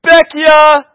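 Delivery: loud, high street cry; vendor yelling. Retold or imitated. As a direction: yell it down the road from a bicycle.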